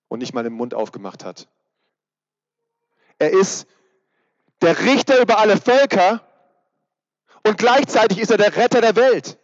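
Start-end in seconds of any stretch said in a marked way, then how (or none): 3.2-9.4 loud, high preaching voice, full force; proclaiming loudly. Real, not acted. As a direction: proclaim it like a herald over all nations. Prediger verkündet Jesus als Richter. (laut verkündend, höher und kräftig)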